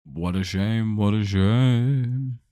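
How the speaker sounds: ordinary talk, nothing marked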